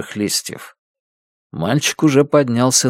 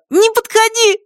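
put-on very high child's warning; small boy, panicky and high-pitched; frightened little boy; 3 dB louder than usual. A narrator's reading. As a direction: cut in with a squeaky child voice. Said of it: "перебил малыш".

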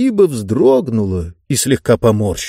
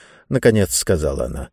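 ordinary delivery

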